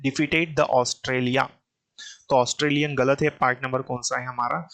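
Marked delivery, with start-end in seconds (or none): 0.1-1.4 reading the corrected words very slowly; teacher slowly reading the corrected version. Shown as a demonstration. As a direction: spell out the fix at a crawl. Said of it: "Australian क्या आना चाहिए यहाँ पर?".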